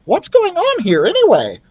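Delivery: very high urgent mouse; high squeak, alarmed. Acted. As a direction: mouse demanding answers. Ralph voiced.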